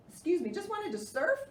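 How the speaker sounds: ordinary delivery, nothing marked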